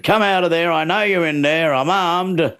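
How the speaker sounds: mock threatening homeowner. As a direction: bark it at the cupboard door. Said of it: "he said".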